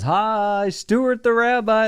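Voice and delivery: high, drawn-out greeting; Stuart Federow. Retold or imitated. Rabbi greeting newcomers at his shul, high drawn-out impression. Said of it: "It's exactly how he talks".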